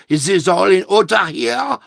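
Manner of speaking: hammed-up German accent, rising at the end; mock German accent. Man ranting about the paperwork check, mock German accent. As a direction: slip into a mocking German accent, climbing to the question.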